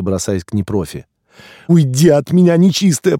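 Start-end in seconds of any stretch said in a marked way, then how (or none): 1.7-3.2 very high, loud, tearful shriek; frantic high wail; hysterical squeal. A narrator's reading. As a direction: shriek it in terror, not in your own voice. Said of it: "плача не своим голосом продолжал визгун".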